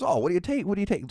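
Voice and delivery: playful mock curiosity; joking question. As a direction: tease him about the pills, voice up. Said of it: "Like, jokingly".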